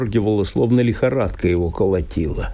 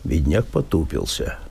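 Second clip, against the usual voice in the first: ordinary delivery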